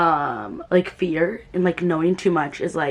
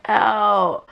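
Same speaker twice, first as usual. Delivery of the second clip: sudden small yelp of pain; a quick startled ow, caught off guard; real little ow. Real, not acted. Vlogger hurts herself mid-sentence, real yelp of pain. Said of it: "my body just reacted like".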